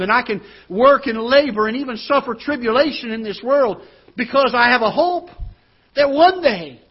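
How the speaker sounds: loud, high, rising preacher's climax; preaching at full force. Acted. Preacher to congregation, loud soaring climax. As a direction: build it up like the sermon's peak.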